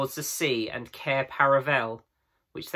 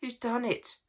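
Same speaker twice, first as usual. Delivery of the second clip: crying out; 10 semitones higher, 5 dB quieter than usual. A narrator's reading. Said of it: "cried Susan".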